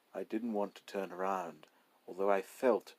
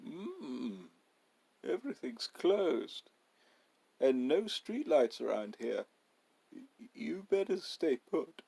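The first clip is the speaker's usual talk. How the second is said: gruff owner warning; 7 semitones higher than usual. Acted. Owner warns the narrator.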